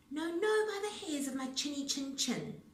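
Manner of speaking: a frightened piglet refusing; pig's voice. A narrator's reading. Pig's reply in story.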